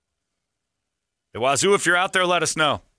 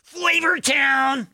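high Guy Fieri impression; 13 semitones higher than usual. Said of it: "Guy Fieri also texted in and said".

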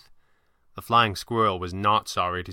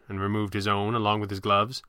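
usual speaking voice

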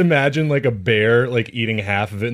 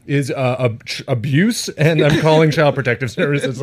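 slow, deliberate, higher-pitched entitled customer; mock-official indignation, slowed and pitched up; mock entitlement; 4 semitones higher, 4 dB louder than usual. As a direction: pronounce it like a self-important legal declaration. Parody of weird entitled customers.

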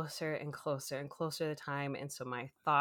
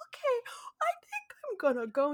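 high, tearful voice breaking; crying; faster than usual. Retold or imitated. Leaving the dental office, crying. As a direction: give up through sobs. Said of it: "I could not stop crying".

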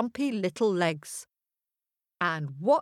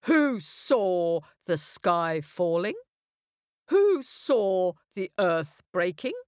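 slow, heavy questioning lion; stern lion; slower than usual. A narrator's reading. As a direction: demand an answer slowly.

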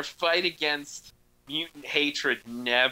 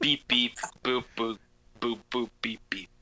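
toy robot voice; 3 semitones lower than usual. Pretend. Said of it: "Droid haikus".

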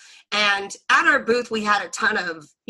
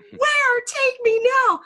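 much higher, louder, giddy excitement; starstruck squeal; 5 dB louder than usual. Retold or imitated. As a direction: squeal it like a fan who just heard her idol is nearby. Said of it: "and so I go".

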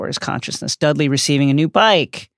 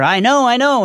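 high, loud cry of triumph; triumphant scream; 11 semitones higher, 5 dB louder than usual. Acted. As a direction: shout it as the answer finally clicks. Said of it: "Harry screams in triumph".

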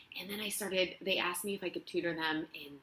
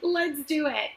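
eager yes; 9 semitones higher, 6 dB louder, slower than usual. Retold or imitated. Retold eager agreement.

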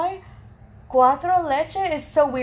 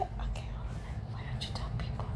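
hushed, unvoiced teasing; whisper; 13 dB quieter than usual. Real, not acted. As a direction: whisper it off mic. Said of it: "speaking away from the mic so they don't know".

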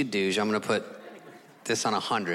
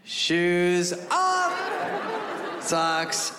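high, strained call-outs; shed weight item by item, voice climbing; mock panicked listing; much slower than usual. Frantic stripping before weigh-in.

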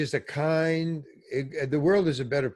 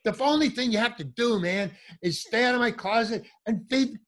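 high, heated scolding; ballistic rant; 8 semitones higher than usual. Retold or imitated. Berating his friend about the dead fish, high heated scolding. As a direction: lay into him, still pitched up with rage. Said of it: "I went ballistics".

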